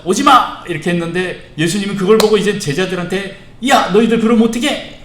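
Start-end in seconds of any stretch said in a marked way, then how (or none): 0.0-0.6 high, loud scolding shout; acted rebuke. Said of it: "아이들을 막 꾸짖었어요". 3.7-5.0 high, loud acted telling-off; angry rebuke. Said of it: "아주 분노하셨다라고 이렇게 표현해요".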